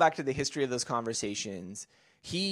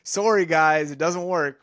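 louder, higher pretend admission of defeat; mock public apology; 4 semitones higher, 9 dB louder, slower than usual. Imagining telling followers the project failed, mock public apology. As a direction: call out a mock apology to everyone.

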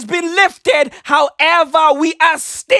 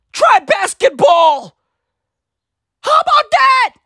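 angry rant; 7 semitones higher than usual. Real, not acted. Ranting at Eastern European gymnasts, exasperated shouting. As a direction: shout it like you're fed up with them.

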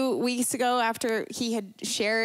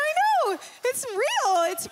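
much higher, louder with a laugh; excited; 13 semitones higher, 4 dB louder than usual. Retold or imitated. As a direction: burst with joyful pride. Joyful telling husband.

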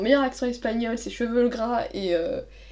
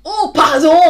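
loud, high, over-the-top apology; exaggerated pardon; 5 semitones higher, 10 dB louder, slower than usual. Telling a friend about a metro bump, hammed-up loud pardon. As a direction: blurt it out like a fake sorry.

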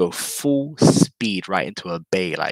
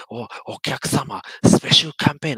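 sing-song salesy advert voice, much louder at the end; mock fax advert; 6 semitones higher, 7 dB louder than usual. Mocking the unsolicited fax ad, hammed-up salesy voice. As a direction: parody a pushy Japanese sales pitch.

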